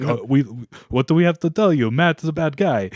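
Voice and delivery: imitating a Russian airport guard's accent. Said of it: "like, an Italian accent? / That was, like, Russian".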